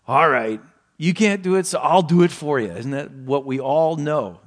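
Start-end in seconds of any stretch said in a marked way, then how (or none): 0.1-2.7 louder, exasperated take-over; impatient parent. Retold or imitated. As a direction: sigh and just do it for them.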